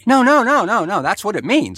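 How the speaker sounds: eager laughing correction; quick protest. Real, not acted. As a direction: rush to correct him. Hurriedly correcting the misunderstanding.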